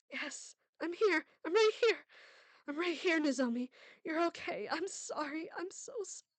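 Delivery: high, trembling tender comfort; tearful relief. Acted. Mother tearfully comforting her waking daughter. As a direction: hold her close, relieved and crying.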